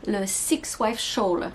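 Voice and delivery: slow English title in a French accent; careful English with a French accent. Real, not acted. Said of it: "pardon pour mon accent, euh, anglais qui est pas terrible".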